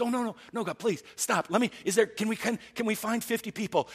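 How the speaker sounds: high, pleading oh no no no; pleading Abram. Retold or imitated. Abram begs God to spare the city, high pleading. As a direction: beg God to stop, voice jumping up.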